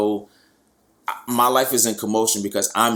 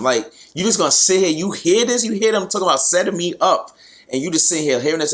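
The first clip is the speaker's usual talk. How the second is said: betrayed outburst; 9 semitones higher, 3 dB louder than usual. Retold or imitated. Venting at betraying family member.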